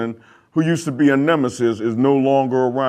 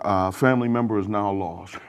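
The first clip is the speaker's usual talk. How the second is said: softer, lower, mildly mournful; a little emotional; 5 semitones lower, 7 dB quieter than usual. Pretend. Host on a player lost to the Patriots, a touch emotional. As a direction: lament a lost player, quietly and slightly put-on. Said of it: "I'm a little emotional right".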